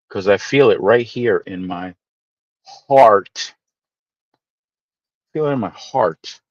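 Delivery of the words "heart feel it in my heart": heart said long and husky, the r drawn out. Acted. Streamer to chat, hamming up his heartfelt heart. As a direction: lean hard into heart, stretched and throaty. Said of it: "I like the way I pronounce that".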